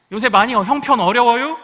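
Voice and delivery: hint at it sideways, Chungcheong style; 충청도 말투로 돌려 묻기; Chungcheong indirect talk. Retold or imitated. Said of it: "우리 충청도는 그렇게 얘기하지 않습니다".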